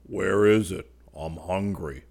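affected eagerness. A narrator's reading. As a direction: play at being eager. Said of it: "replied Fallowby with affected eagerness".

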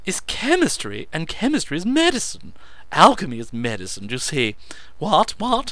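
high, loud oratory; orating. A narrator's reading. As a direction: declaim it like a lecture. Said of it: "he orated".

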